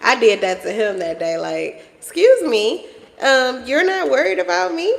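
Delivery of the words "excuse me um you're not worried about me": high, laughing mock offence; playfully affronted, pitched well up; mock indignant. Mother to son who isn't checking on her, mock indignant. Acts herself demanding son's attention.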